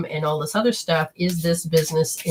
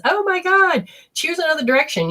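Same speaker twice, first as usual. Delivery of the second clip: mock alarmed spirit; 9 semitones higher than usual. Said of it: "Spirit says".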